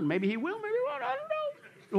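sing-song doubter sliding up into falsetto; mock uncertainty. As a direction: voice climbing very high, soft. Mimics doubt about God answering.

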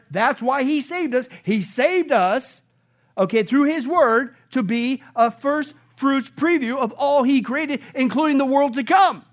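slow, loud, emphatic preaching; building sermon climax; preaching at full force. Real, not acted. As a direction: hammer it home to the congregation.

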